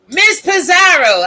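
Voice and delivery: high, loud hail by name; big playful call-out. Real, not acted. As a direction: holler the name to summon someone. Calls out to another creator.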